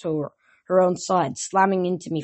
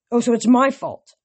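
loud, higher sarcastic jab; sarcastic retort; 6 semitones higher, 5 dB louder than usual. A narrator's reading. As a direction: bite back with sarcasm. Hero arguing with Thalia.

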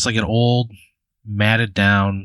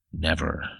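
low, hushed creature warning; grave warning; 4 semitones lower, 8 dB quieter, slower than usual. Acted. Bigfoot stands and warns the visitor, low and quiet. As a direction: drop low and soft, word by word.

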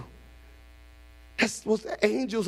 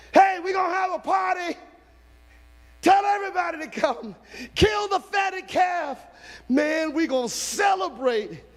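joyful call to servants; 10 semitones higher than usual. Acted. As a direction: call the party out. Father ordering party.

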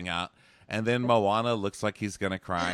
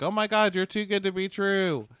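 high gushing swoon; mock-gushing fangirl voice; gushing girlfriend; 11 semitones higher, 4 dB louder than usual. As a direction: swoon over him, high and breathless with delight. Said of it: "And she's like".

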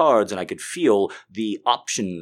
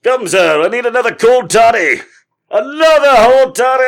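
put-on high, loud old-timey bellow, building; demanding drunken scallywag; 12 semitones higher, 10 dB louder than usual. Scallywag calling for another toddy, hammed-up high shout. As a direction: holler for a drink, louder on the repeat.

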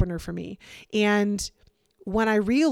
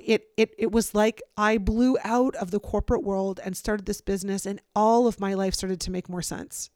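usual speaking voice

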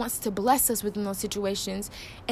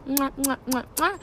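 blowing kisses; 5 semitones higher than usual. Real, not acted. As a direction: blow kisses to the audience.

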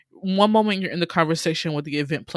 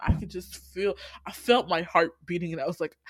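ordinary delivery

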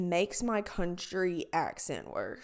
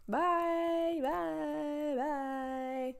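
sing-song goodbye; 4 semitones higher, much slower than usual. Real, not acted.

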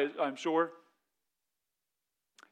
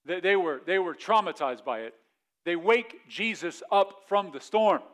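usual speaking voice

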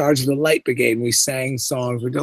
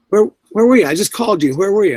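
higher, louder, alarmed demands; frantic questioning; 6 semitones higher, 6 dB louder, faster than usual. Retold or imitated. Young officer who lost sight of him, frantic and loud. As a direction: press him, rattled and urgent.

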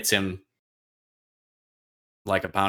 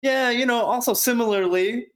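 much higher, airy mock-innocent voice; sarcastic Octavia; 13 semitones higher than usual. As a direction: play it coy and much higher. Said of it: "Octavia's like".

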